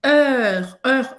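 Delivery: "Er, er" with lips rounded like O, tongue for E; French eu sound. Shown as a demonstration. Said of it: "Você vai fazer a boca do O e falar E".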